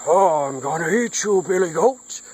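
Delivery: storybook troll pouncing on his meal; menacing troll. Acted.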